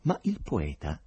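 usual speaking voice

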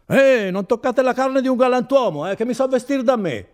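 high, loud yell of protest; indignant shouting. Acted. Renzo dragged from bed by officers, shouting indignantly. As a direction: yell at them to keep their hands off.

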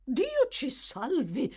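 put-on old lady startled into crying out; startled exclamation. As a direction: jump at the words, alarmed. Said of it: "esclamò la governante, trasalendo".

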